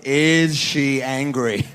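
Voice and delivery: loud, very drawn-out quizmaster question; slow quiz question. Acted. Dad quizzing his son on a photo, slow deliberate question. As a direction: stretch every word out for the child to study.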